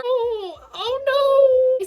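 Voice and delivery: high, loud rising oh no; dismayed outcry. Real, not acted. Fan reacting to a sad twist, dismayed outcry. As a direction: gasp it out in dismay.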